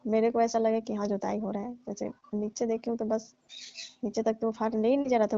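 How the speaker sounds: usual speaking voice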